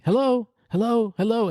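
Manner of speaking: calling into a phone that's cutting out; mom on a bad line. Mom on the phone who can't hear her son, calling high. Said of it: "She's like".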